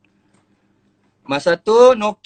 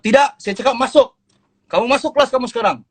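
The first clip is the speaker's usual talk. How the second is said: stern teacher order; 7 semitones higher, faster than usual. Retold or imitated. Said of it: "Cikgu Petak cakap".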